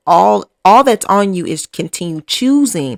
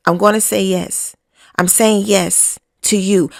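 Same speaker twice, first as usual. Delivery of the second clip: ordinary delivery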